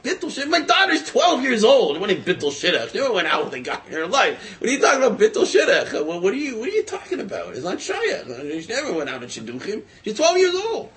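a father protesting in disbelief, half shouting; indignant outburst. Retold or imitated. Father outraged at the messenger.

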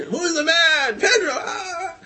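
very high mock cheering; retold chant trailing into crowd roar; screamed call-and-response. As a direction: imitate hero and roaring crowd. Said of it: "And he's screaming out".

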